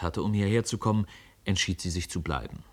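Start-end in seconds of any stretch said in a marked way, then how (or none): none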